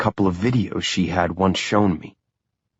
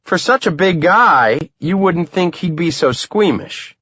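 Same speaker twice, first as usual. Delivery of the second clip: higher teasing woman's voice; playful jab at her husband; teasing wife; 10 semitones higher than usual. A narrator's reading. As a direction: rib him, lightly voiced wife. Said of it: "She shakes her head and teases".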